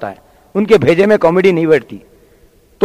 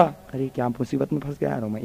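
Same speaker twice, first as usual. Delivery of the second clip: very soft, lower grumble to himself; worried aside; 5 semitones lower, 16 dB quieter than usual. Acted. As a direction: lament your trouble under your breath. Trapped comedian grumbles to himself. (मन ही मन बड़बड़ाते हुए)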